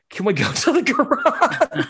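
high, hesitant, sheepish request; nervous kid asking to move; sheepish stalling. Retold or imitated.

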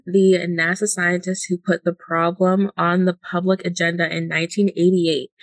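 ordinary delivery

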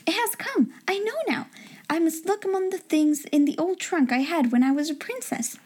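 high, eager rush of discovery; understated triumph in a girlish voice; excited cry. A narrator's reading. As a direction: exclaim lightly, pitched well up. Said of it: "she cried".

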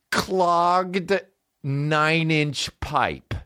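very slow, drawn-out title; dramatic reading. Real, not acted. Comic announcing the ad title, very slow. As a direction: read it like a movie title. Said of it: "reading title dramatically".

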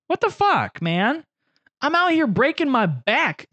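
high, indignant squeal; mock outrage. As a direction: play up the offense.

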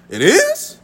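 high-pitched, amused disbelief; mock surprise. Son hearing his tire pressure from dad, mock surprise. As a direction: replay being caught out, voice jumping high.

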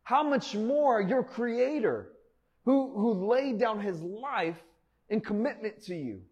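preacher's climax; preaching. Real, not acted. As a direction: lift it up and slow it down.

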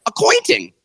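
high, loud pitchman punch on "Accointing"; hyped ad voice. Retold or imitated. Host teasing the sponsor read, in a high hyped pitchman voice. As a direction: sell "Accointing" like an overexcited marketer. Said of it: "Would you be like".

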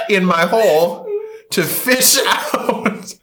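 very slow, stretched and laughing; slow laughing drawl. Real, not acted. Telling a friend, slowly savouring the gross punchline. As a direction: draw it out for comic effect.

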